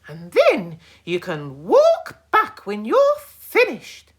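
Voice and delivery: loud angry shout; granny shouting at boys. A narrator's reading. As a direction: shout the punishment. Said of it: "she shouted".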